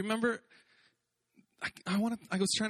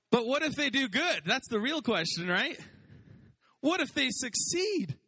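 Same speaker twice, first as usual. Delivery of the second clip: mock excited retort; 10 semitones higher than usual. Answering friends' doubts about the worship team, excited and emphatic. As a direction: push back with bright conviction. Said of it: "I'm like".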